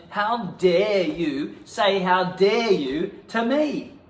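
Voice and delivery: slow, loud, high mock outrage; drawn-out indignation. Actor in improv, indignant at being told off. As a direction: stretch each word, offended.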